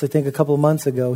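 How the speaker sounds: ordinary talk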